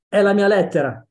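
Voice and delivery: loud, high protest; indignant shout. Acted. Teddy insisting on his own letter, loudly indignant. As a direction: claim it as yours, shouting.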